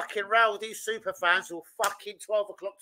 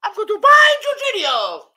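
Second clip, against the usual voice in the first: high, hammed-up superhero boast; mock hero; 11 semitones higher, 9 dB louder than usual. Mocking fans who crowned themselves heroes in a high heroic voice. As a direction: play the fans' smug saviour. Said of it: "take off my cape and mask".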